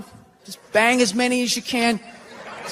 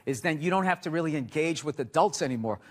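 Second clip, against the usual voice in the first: normal talking voice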